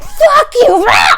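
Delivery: mock enraged shriek, very high and loud; foaming at the mouth. Accused friend overreacting to a phone call, mock raging shriek. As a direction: explode in a cartoonish rabid outburst. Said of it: "foaming at the mouth".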